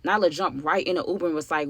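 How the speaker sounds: normal talking voice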